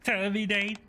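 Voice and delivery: glitchy machine voice, high pitched; robot voice. Acted. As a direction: speak like a malfunctioning machine. Robot enemy voice.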